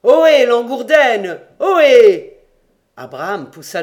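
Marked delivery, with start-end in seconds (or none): 0.0-2.4 very loud, high hail; calling out. A narrator's reading. Crew hail the bear again from the ladder, shouting. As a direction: holler it up high, insistently. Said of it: "les voix de ceux qui avaient appelé déjà répétèrent".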